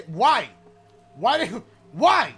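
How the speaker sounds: loud panicked why; startled yelling. Real, not acted. Startled in horror game.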